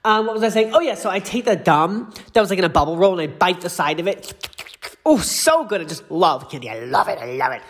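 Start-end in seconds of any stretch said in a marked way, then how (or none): none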